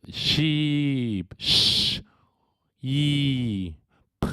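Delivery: "Sheep" then split into its sounds, sh, ee, p; sounds stretched apart. Shown as a demonstration. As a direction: pull "Sheep" apart sound by sound like chewing gum. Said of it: "estirar los sonidos de las palabras como chewing gum". (estirar "Sheep" en sus sonidos: sh, i, p)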